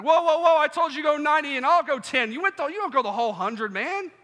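loud high-pitched outraged Will Smith; upset yell. Acted. Will Smith yelling at Kevin James, shocked and upset. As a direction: shout it, startled and offended. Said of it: "that was good acting right there".

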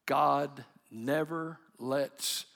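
drawn-out, word by word, for emphasis; slow it right down and land each word; emphatic slow preaching. Acted. Preacher closing the sermon on God's faithfulness, very slow and emphatic.

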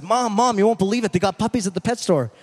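put-on excited boy. Boy bursting in to tell his mom, excited. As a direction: blurt it out like a thrilled kid.

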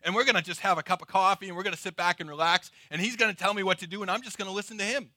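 breezy, fast, easygoing chatter; casual delight. Acted. Churchgoer imagines a relaxed coffee with Jesus, breezy and pleased. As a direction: rattle it off lightly, as if planning coffee. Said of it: "we'd hear that and we think".

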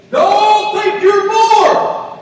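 high, emphatic pulpit shout; full-force preaching. Real, not acted. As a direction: drive it home to the congregation, loud and high. Preaching climax to congregation.

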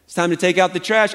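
ordinary talk

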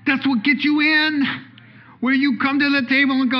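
high, loud proclamation; voicing Jesus. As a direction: declare it as Jesus, ringing out. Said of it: "Jesus is saying".

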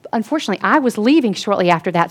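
usual speaking voice

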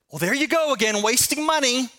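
hammed-up spouse scolding about money; mock exasperated jab. Spouse lobbing a self-righteous bomb at spouse, mock exasperated. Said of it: "goes kinda like this".

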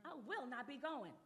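very soft, affronted refusal; indignant queen. Acted. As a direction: quietly play a queen refusing the summons. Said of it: "she gets all, um, indignant".

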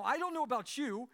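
ordinary delivery

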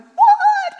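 loud, very high burst of laughing surprise; laughing shock. Retold or imitated. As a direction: yelp of joyful disbelief. First tongues coming out.

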